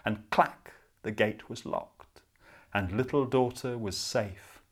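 ordinary delivery

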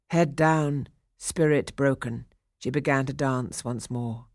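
ordinary delivery

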